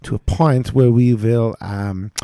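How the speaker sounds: put-on high, loud German pilot accent; German captain on the intercom, slowly. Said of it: "captain was German".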